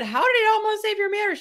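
high, fast disbelieving question; surprised question. Retold or imitated. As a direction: ask it high and quick, amazed. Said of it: "and I said".

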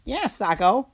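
high cartoon sidekick reply; Slurpee. A narrator's reading. Slurpee answers Socko, high cartoon voice. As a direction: agree in a high character voice. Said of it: "replied Slurpee".

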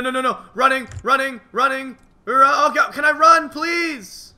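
high, loud panicked shouts; panicked yelling. Real, not acted. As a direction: yell in panic while fleeing. Fleeing an attacker in panic.

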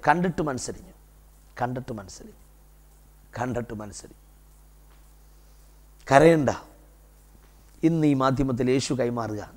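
hushed, low pastoral consolation; tender preaching. Real, not acted. Preacher telling weeping families "do not cry", tender and hushed. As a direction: let it drop soft and low to comfort the weeping.

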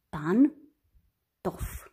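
"Pantof" in two separated syllables, pan - tof; despărțit în silabe. Shown as a demonstration. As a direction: split "Pantof" with a long gap after pan. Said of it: "Despărțim în". („Pantof” rostit rar, pe silabe: pan - tof)

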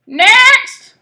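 very loud, high parrot screech; blaring parrot. Acted. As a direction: blare it out as a parrot. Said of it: "blared the parrot".

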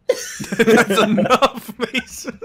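laughing through the words. Real, not acted. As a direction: scold him while laughing. Laughing telling teammate off.

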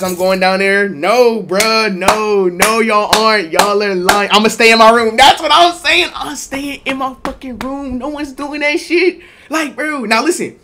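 loud high back-and-forth; loud mock argument. Friends claim they'll go, loud arguing. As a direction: yell them down.

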